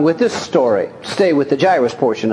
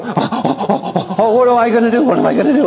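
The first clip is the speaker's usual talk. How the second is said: mock panic; 5 semitones higher, much faster than usual. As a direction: fake the worried father who loses his nerve. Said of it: "And Jairus didn't go".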